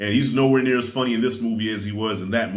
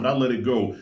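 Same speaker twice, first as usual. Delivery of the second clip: ordinary talk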